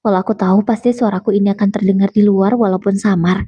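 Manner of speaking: plain reading voice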